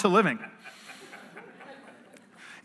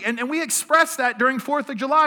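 higher, louder preaching push, building on freedom; rising preaching; 8 semitones higher, 5 dB louder than usual. Acted. Pastor rallying the congregation about freedom, fervent preaching. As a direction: preach it out to the room with growing force.

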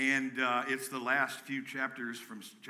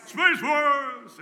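much higher, loud mock-grand call; 13 semitones higher, 11 dB louder than usual. Real, not acted. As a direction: announce it big. Calling out, joking.

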